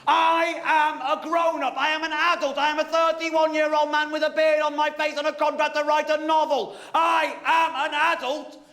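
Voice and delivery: high, loud, stubborn shouting; hammed-up grown-up tantrum; mock temper tantrum. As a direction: stamp it out like a sulking child. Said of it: "having a temper tantrum".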